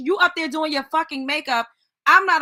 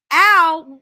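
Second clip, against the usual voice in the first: louder, imitating a child's cry; loud imitated ow; 3 semitones higher, 10 dB louder than usual. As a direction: belt out the baby's ow. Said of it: "what are you".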